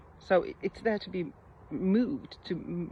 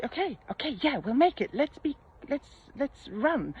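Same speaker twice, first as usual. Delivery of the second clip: bright and higher, an easy-going let's-go cheer; upbeat encouraging; 7 semitones higher than usual. Retold or imitated. Mother gently cheers her son on, upbeat and higher. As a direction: lift the energy and reassure the boy. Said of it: "Completely different energy".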